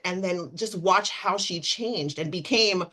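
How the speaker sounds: usual speaking voice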